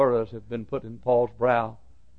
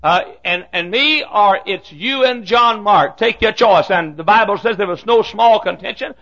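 high, loud pulpit shout; full-force preaching; 11 semitones higher, 11 dB louder than usual. Real, not acted. Preacher telling of Paul and Barnabas's split, full-force preaching. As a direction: thunder the story out to the back pews.

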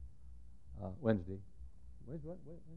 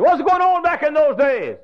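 high, loud challenging question; mock listener question; 11 dB louder than usual. Preacher voicing congregation's question, loud and high. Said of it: "you say".

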